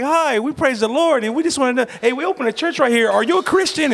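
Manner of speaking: high, sweet-talking church lady at the door; church lady impression. Voicing church ladies at the door.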